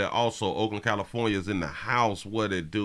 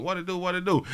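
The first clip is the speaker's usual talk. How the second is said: high greeting; 9 semitones higher, faster than usual. Real, not acted. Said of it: "high greeting".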